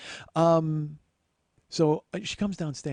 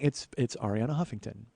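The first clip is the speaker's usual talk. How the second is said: usual speaking voice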